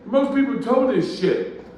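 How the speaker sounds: high, loud neighbor voice; emphatic thanks. Retold or imitated. Huge neighbor thanking him for not towing, loud and emphatic. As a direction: imitate a big neighbor loudly showing appreciation.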